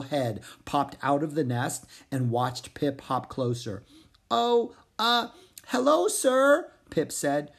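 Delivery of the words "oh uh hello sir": high, slow, nervous frog greeting; startled frog. Acted. Pip greeting the hippogriff father, startled and high. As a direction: caught off guard by a huge eagle.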